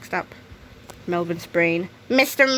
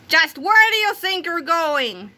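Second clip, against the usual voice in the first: shout; 7 semitones higher, slower than usual. A narrator's reading. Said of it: "shouted Melvin".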